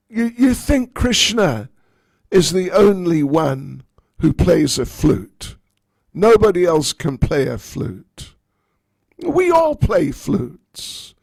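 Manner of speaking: high, loud scornful brag; arrogant. Acted. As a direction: talk down to her, loud and haughty. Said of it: "said very arrogantly".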